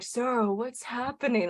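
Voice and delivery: words stretched as if heard underwater; slow-motion voice. Retold or imitated. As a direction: mimic someone talking in slow motion. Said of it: "like, in slow motion".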